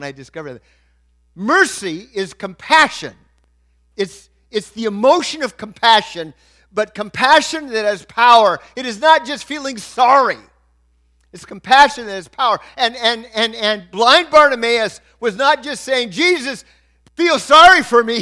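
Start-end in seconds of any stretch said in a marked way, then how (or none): 1.3-16.1 loud, raised sermon delivery; forceful preaching. Real, not acted. 16.1-18.2 loud, high imitated appeal; preacher voicing a plea at raised volume; retold beggar's plea. Acting out blind beggar's plea.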